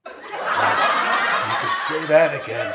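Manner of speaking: slow, laughing reply; rueful laugh. A narrator's reading. George answering Hazel with a rueful laugh. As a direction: laugh it off wearily.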